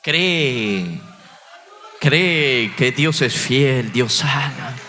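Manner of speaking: low, loud, slow booming command; voice of God. Retold or imitated. God speaks to the despairing pastor in a slow booming voice. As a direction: thunder it down like a divine voice. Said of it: "Dios me habló a través de un testimonio".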